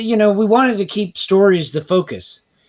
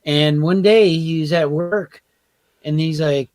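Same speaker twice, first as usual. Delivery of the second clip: usual speaking voice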